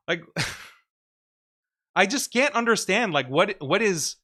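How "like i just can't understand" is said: ordinary delivery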